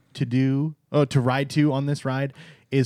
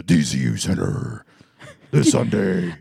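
monster truck ad; 3 semitones lower, 4 dB louder than usual. Pretend. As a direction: punch it like a radio ad. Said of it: "You know the monster truck commercials?".

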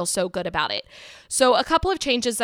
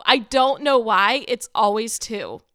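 ordinary delivery

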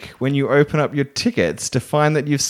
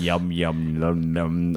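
low yum noise; 7 semitones lower, 5 dB quieter, much slower than usual. Retold or imitated. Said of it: "on the bus going".

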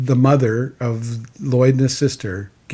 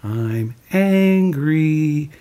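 very slow, drawn-out higher modelling; slow, raised-pitch teaching example; Mister Rogers; 5 semitones higher, much slower than usual. Retold or imitated. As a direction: stretch each word for someone to copy. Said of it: "He goes".

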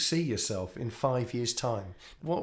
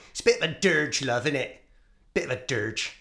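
imitating mum; 3 semitones higher, 4 dB louder than usual. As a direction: do your mum passing blunt judgement on a song. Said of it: "Is that how your mum speaks?".

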